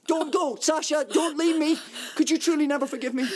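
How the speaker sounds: husband begging his wife to stay, desperate; desperate pleading. Acted. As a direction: plead as she turns to go. Husband begs wife not to leave.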